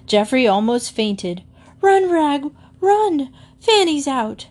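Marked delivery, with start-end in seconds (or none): 1.8-4.5 high, loud panicked shout. A narrator's reading. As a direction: yell the warning at the top of your voice. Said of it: "he shouted".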